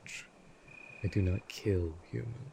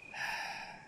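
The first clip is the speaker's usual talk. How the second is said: soft, breathy sigh of impatience; quiet exasperated exhale; weary sigh; 6 dB quieter than usual. Acted. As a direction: sigh out, tired of the visitor. Vampire tires of the conversation.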